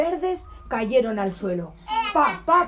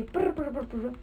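soft prr droppings sound; 8 dB quieter than usual. Acted. Goat making droppings sound.

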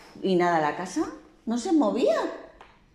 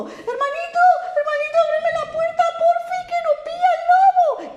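very high, fast, louder pleading squeal; high piglet begging brother to open; begging little pig; 7 dB louder than usual. A narrator's reading. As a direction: squeak a desperate plea, laughing. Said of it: "y le dijo".

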